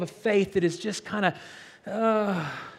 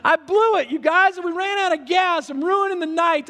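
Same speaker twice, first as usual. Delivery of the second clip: high, loud dismayed cry; distressed; 10 semitones higher, 5 dB louder than usual. Retold or imitated. Pastor retelling the night the car ran out of gas, high and loud dismayed. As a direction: blurt it out like a guy who wrecked the date. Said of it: "and I'm going".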